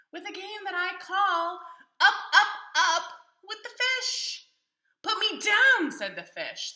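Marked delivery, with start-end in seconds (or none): 0.1-4.5 high, loud, slowing gleeful cat with a laugh; gleeful announcing. Acted. Cat announces his game. 5.0-5.9 high, loud frantic cry of the fish; panicky squeal. Acted. Fish panics being lifted.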